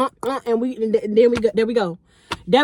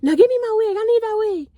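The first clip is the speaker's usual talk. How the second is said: put-on drunk friend demanding; 10 semitones higher than usual. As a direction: whine high like a drunk friend. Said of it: "she like".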